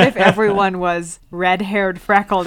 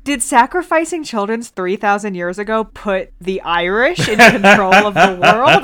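ordinary talk, nothing marked

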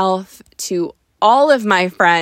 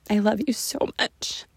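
soft loving whisper; mother whispering love to her daughter; tender whisper; 5 dB quieter than usual. Real, not acted. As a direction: breathe it out gently.

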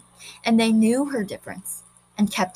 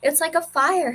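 put-on young girl voice; 7 semitones higher than usual. A narrator's reading. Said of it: "she said".

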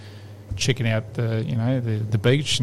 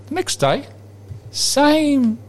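ordinary talk, nothing marked

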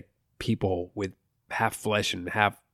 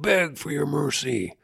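villain taunt; 5 semitones higher, slower than usual. Retold or imitated. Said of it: "He's like".

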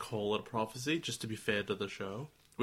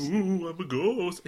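high mournful keening; 6 semitones higher, 5 dB louder, slower than usual. Pretend. Mock ghost after Gatomon wail.

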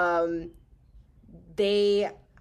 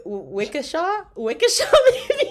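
unsure rising; 10 semitones higher, 8 dB louder than usual. Real, not acted.

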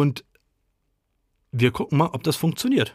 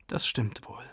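very soft, resigned agreement; sighing; 11 dB quieter than usual. A narrator's reading. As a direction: sigh it out quietly. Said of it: "seufzt Mama". (leise seufzend)

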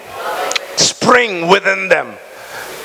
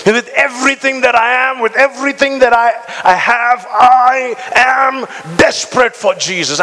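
higher, fervent build; impassioned preaching; 4 semitones higher than usual. Acted. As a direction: pour it out, rising higher.

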